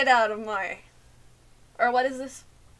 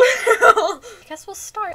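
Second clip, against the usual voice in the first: slow, higher, laughing through it; laughing drawl; 8 semitones higher, 4 dB louder, much slower than usual. Pretend. Streamer hams up starting the game, laughing. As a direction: drag it out while giggling.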